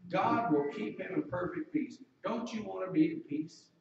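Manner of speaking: normal talking voice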